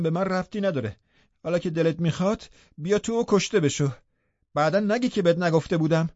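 acted exasperated last warning; heated warning. A narrator's reading. Heated quarrel through the window.

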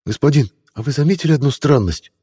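put-on high breathless boy's question; breathy. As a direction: ask with a hushed breath. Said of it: "с придыханием спросил мальчишка".

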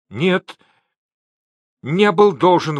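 high, emphatic denial; exclaiming. A narrator's reading. Gao denying the debt to angry Gilyaks, exclaiming. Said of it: "воскликнул Гао".

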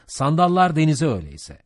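loud, high captain's shout; roaring command to the crew. A narrator's reading. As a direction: bellow orders across the deck. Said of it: "Ahab güverteye doğru bağırdı".

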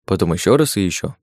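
ordinary delivery, nothing marked

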